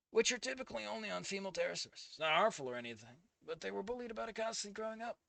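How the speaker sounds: soft, hushed fic reading; hushed narration of a private moment; story narration. Acted. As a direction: read it like a quiet story passage.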